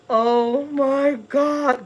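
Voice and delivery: gasp, then high, drawn-out dismay; retold shock. Mother hears her son was taken away, shocked. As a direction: stretch every word slowly after a gasp. Retold reaction to bad news.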